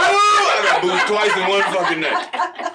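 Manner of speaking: high, slow laughing yell; cracking up at his own bombing; laughing shout. Real, not acted. Punchline retelling disaster.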